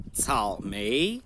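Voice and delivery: "草莓" slow, low "草" then rising "莓"; third plus second tone. Shown as a demonstration. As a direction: drop low on "草", lift "莓". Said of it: "terceiro e segundo". ("草莓": grave, depois subindo)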